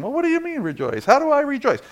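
high-pitched, hurried imitation of a doubter; mock puzzled questioner. Mimics a puzzled, questioning listener.

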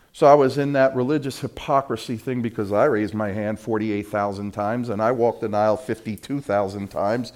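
ordinary delivery

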